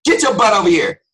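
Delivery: loud, high barked order; hammed-up angry parent shout; scolding parent. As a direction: yell at a child to come now. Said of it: "talking to kids like that".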